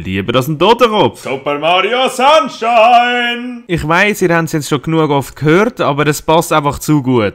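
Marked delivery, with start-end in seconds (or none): 1.2-3.6 slow, high, drawn-out title call; announcer voice. Acted. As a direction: sing out the game title.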